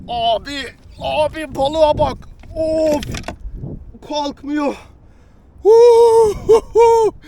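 high, loud struggling cries; excited straining. Real, not acted. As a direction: strain and shout while lifting. Fish won't lift, straining. (heyecanla zorlanarak)

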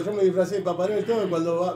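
normal talking voice

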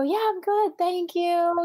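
high, drawn-out singsong brush-off; fake cheerful. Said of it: "you don't tell people how you feel".

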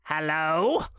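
mock-gruff Scrooge greeting, swooping up at the end; feigned growl. A narrator's reading. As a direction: growl it like a boss pretending displeasure. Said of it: "growled Scrooge in his accustomed voice, as near as he could feign it".

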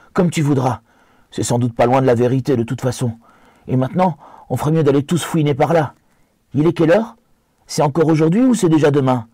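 hobbit conciliant mais méfiant, plus aigu; céder puis questionner, voix haute; Sam méfiant. Acted. (Sam, ton vif et plus aigu)

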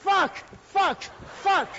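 فاک said slow and loud, the پ of پاک turned into ف; mispronouncing پاک. Acted. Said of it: "بله؟ چی فرمودن آقا؟". (پاک را کشیده و بلند فاک بگو)

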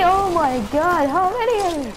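high, rising alarm; panicked at the enemies; panicking gamer. Real, not acted. Enemies rushing in.

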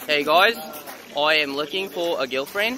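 broad put-on Australian accent; showy Australian-accent announcement; Aussie accent. Shown as a demonstration. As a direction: demonstrate a playful Aussie accent. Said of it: "I want you to rate my Aussie accent".

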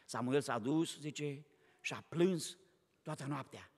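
low, soft and slow; quiet sorrowful narration; hushed telling. A narrator's reading. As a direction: sink the voice into grief. Telling Samuel wept all night.

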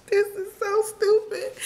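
high, tearful whisper through sobs; really crying. Real, not acted. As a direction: squeak it out while crying. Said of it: "I started crying already".

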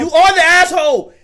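blurting it out loud; loud verdict. Real, not acted. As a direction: call him the asshole at full volume. Shouting verdict at clip.

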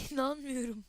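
shaky laughter after a scare; nervous laugh. Real, not acted. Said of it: "Sinirden gülüyorum şu an yani".

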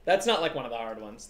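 ordinary talk, nothing marked

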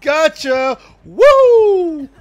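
very loud, high victory scream; exultant high-pitched cheer; triumphant whoop. Real, not acted. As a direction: scream it high as you win. Triumph after getting opponent.

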